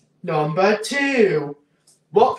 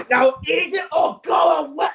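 mock yell; 7 semitones higher than usual. Yelling at the game character.

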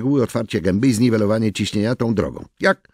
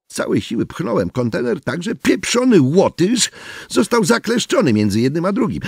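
ordinary delivery

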